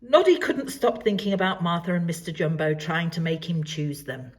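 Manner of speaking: ordinary delivery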